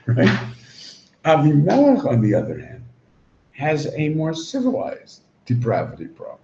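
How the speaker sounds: ordinary talk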